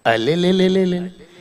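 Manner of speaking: put-on slow, soft wailing baby voice; baby crying. Comedian mimicking baby Shahrukh wailing in his cradle, soft baby cry. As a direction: cry like a spoiled newborn.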